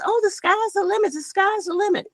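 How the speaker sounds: high singsong parroting of a cliché; mocking quote. Mimicking what people always told us, high mocking singsong.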